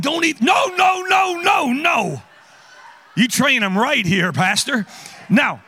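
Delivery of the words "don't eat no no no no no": urgent no. Real, not acted. Stopping a kid eating candy.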